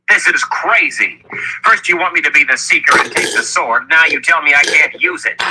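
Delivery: high-pitched indignant complaint; furious protest. A narrator's reading. Richard told he can't use the sword, furious protest. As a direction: object hotly, voice riding high. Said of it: "Richard was furious".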